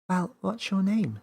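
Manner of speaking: put-on high little girl voice; shy girl asking a name; Millie asking. A narrator's reading.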